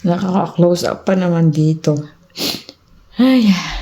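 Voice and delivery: breathless after a laughing fit; panting between words from laughter; out of breath from laughing. Real, not acted. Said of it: "Hiningal ako katatawa".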